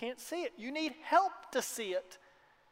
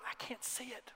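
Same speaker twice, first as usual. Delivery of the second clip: confused whisper; 2 semitones higher, 13 dB quieter than usual. Retold or imitated. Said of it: "And I look at him and I go".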